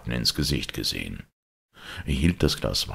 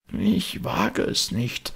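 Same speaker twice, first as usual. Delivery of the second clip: fearful; 11 semitones higher, slower than usual. A narrator's reading. Frightened servant refuses.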